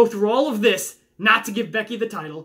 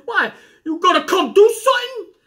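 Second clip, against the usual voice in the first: playful fake confrontation, higher and louder; mock challenge; 10 semitones higher, 5 dB louder than usual. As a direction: pretend to square up to a nosy viewer, jokingly. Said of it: "No, I'm playing".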